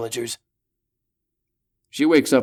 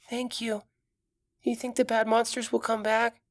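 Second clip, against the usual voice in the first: put-on scared little girl, much higher; small frightened child; 12 semitones higher, 4 dB quieter than usual.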